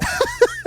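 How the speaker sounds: sudden high squeaky laugh; high squeal of laughter. Real, not acted. Laughing at humanity.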